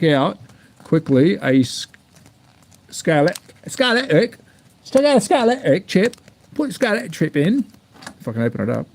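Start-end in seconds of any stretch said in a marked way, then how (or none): 2.9-7.6 mock ad voice. Mock ad voice for chip brand.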